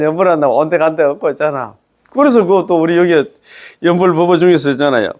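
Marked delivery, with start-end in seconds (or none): none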